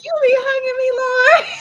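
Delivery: high, loud, laughing cry to God; overjoyed, breaking into laughter; giddy squeal. Retold or imitated.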